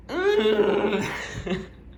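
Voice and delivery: high, drawn-out muffled squeal; playful high-pitched wail; mock scream.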